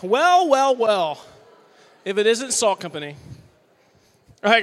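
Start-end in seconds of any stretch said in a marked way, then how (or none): 0.0-1.2 loud, drawn-out gloating well well well; hammed-up sinister welcome; mock villain well well well. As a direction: greet them like a smug villain who caught someone.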